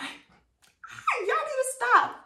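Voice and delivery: high, slow drawn-out shout; overwhelmed squeal. Retold or imitated. Vlogger squealing at the sweet couple, high and drawn out. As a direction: beg them to stop being cute.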